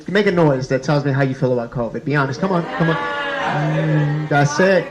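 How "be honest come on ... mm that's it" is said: ordinary delivery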